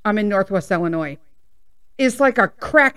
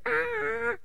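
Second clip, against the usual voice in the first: dog noise; 13 semitones higher, 9 dB quieter than usual. Retold or imitated. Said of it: "and he's like".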